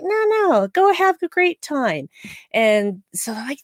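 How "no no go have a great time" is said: high, bright-pitched mock reassurance; cheery insistence. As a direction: wave them off happily in a put-on voice. Fletcher voiced in a retold scene.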